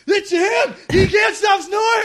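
very high, shouted caricature; piercingly high imitation of a woman yelling; mock shouting woman. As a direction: shriek it like a heckler interrupting the show. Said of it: "she's just shouting and pointing at her husband".

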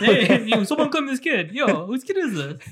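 high, playful holler to other drivers; mock yelling. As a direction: shout around like a bewildered bus driver. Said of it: "he starts yelling at other bus drivers".

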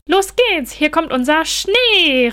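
high, loud, stretched out slowly; excited call. A narrator's reading. Julius to Holger pouring flour, loud and delighted. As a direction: cheer as the snow pours out. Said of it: "rief Julius begeistert".

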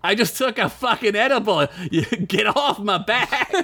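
put-on Boston accent, stoned and annoyed; Boston man. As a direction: whine it like a high Boston guy. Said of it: "He replies".

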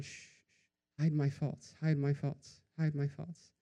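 a breathy shush, then the plea kept soft; hushed pleading. Retold or imitated. Imam Ali quietly hushing the shouting man after prayer, softly. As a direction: hush him gently so no one else hears.

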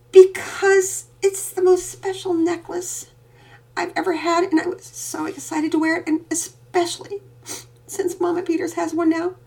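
put-on a little girl pouring out her longing; upset little girl. A narrator's reading. Daughter explaining to her father why the necklace matters. Penelope voiced high.

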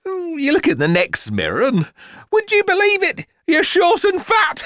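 high-pitched mother bursting into laughter; laughing. A narrator's reading. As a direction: build from giggly telling into helpless laughter. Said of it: "Her laughter came to an abrupt halt".

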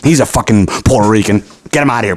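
usual speaking voice

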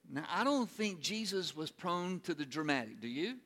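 ordinary delivery

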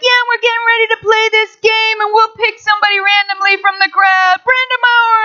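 high booming stadium announcer call. Retold or imitated. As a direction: call it out over the loudspeaker like the announcer. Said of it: "and he's like".

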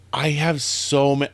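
normal talking voice

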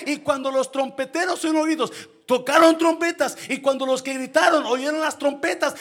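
loud, high-pitched preacher's shout; fiery preaching. Acted. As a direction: preach it at full force to the church. Preaching at full force. (predicación a todo pulmón, alta y fuerte)

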